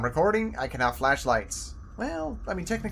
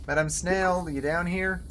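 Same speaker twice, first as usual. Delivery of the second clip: ordinary talk, nothing marked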